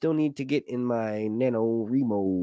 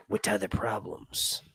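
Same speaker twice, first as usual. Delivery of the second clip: slow whispered aside; 6 dB quieter, slower than usual. Pretend. Host finishing a joke line in a slow whisper. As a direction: whisper it like a secret.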